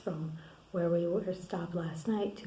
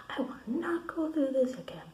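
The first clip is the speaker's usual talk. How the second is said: higher-pitched mock outburst; retold angry yelling; 6 semitones higher than usual. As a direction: imitate someone refusing in a fury. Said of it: "she's yelling at me".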